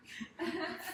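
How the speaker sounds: short bright laugh before the big reveal; a quick giddy laugh. Real, not acted. Dramatic self-introduction.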